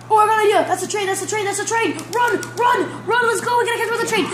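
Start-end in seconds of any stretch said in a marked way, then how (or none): 2.1-4.3 high urgent shouting; hurry, shout run; being chased. Real, not acted. Racing to catch a train.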